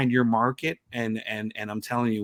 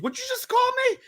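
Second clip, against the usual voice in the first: indignant dad; faster than usual. Retold or imitated. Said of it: "he goes".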